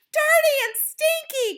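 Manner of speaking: high, loud crowd echo; cried out. A narrator's reading. Audience echoing the Grand High Witch, cried out. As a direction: cry it back, worked up. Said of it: "cried the audience".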